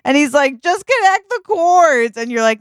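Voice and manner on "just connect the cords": high-pitched, exasperated order; bossy. Acted. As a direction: give a pushy, raised-pitch command. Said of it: "Now you're so bossy".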